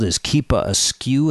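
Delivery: ordinary delivery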